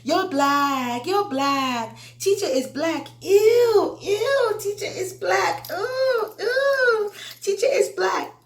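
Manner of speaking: put-on high squealing childish taunt, ew and ooh in a shrill child voice. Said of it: "the student just kept taunting me".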